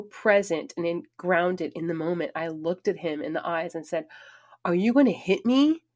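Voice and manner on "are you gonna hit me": higher and louder, squaring up to him; defiant question. Retold or imitated. Woman confronting her raised-hand boyfriend, higher, louder, defiant. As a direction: demand it straight into his eyes.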